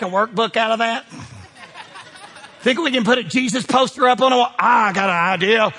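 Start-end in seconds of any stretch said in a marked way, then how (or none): none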